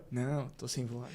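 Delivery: soft, low, slow mumble of a man claiming no voice; lightly mimicked weak voice; mock hoarse excuse. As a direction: feign having lost your voice, quietly. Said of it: "Assim mesmo".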